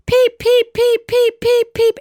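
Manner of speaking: very high, loud, squeaky peep peep peep; frantic gosling peeps. Retold or imitated. Telling friends about the lost gosling, high frantic peeping. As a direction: mimic a scared baby goose screaming for its mom. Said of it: "And it's so afraid".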